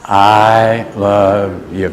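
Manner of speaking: very slow, drawn-out chant; leading in unison. Real, not acted. Preacher leads a repeat after not hearing the names, slow and drawn out. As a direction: hold each word for the crowd. Repeats as group too quiet.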